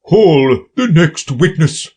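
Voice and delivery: slow commanding king. A narrator's reading. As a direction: call it out royally.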